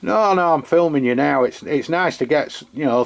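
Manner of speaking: ordinary delivery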